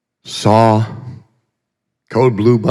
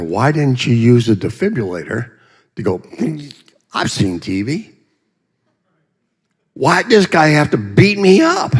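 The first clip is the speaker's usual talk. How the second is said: indignant question; 5 semitones higher than usual. Retold or imitated. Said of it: "I asked the doctor".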